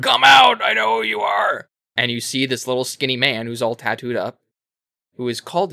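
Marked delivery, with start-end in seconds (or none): 0.0-1.7 high, loud shout through the door; retold shout. Stranger yelling at Kratos outside the cabin, loud and high. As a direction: bellow it out to flush him from the cabin.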